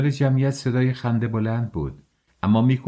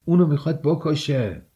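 higher-pitched, mildly shouted outcry; crowd cry; 6 semitones higher than usual. A narrator's reading. Onlookers in the crowd reacting to the beating, shouting. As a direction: cry out in protest, pitch raised.

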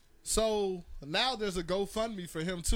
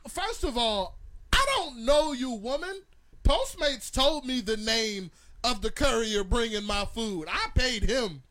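higher, louder angry homeowner; loud indignant shout; 5 semitones higher, 6 dB louder than usual. Retold or imitated. Homeowner yelling at Karen on the intercom, loud. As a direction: bark it back at her, indignant. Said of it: "I'll bring".

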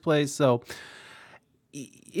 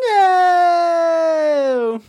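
put-on high shouted girl's no; 10 dB louder than usual. Imitating a girl in the crowd yelling no. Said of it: "you hear this girl say".

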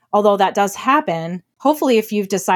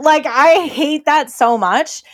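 exasperated; 3 semitones higher than usual. Real, not acted. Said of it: "Don't make me punch you".

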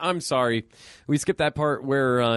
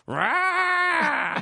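imitating a deranged hunter's rage face; rage roar. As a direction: let out a deranged rah.

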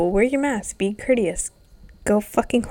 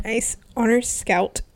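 ordinary talk

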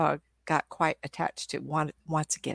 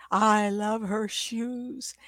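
higher, very drawn-out doggy swoon; slow lovesick dog; besotted dog's voice; 4 semitones higher, much slower than usual. Acted. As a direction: stretch every word with adoring longing. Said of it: "it was the way the dog said it to me".

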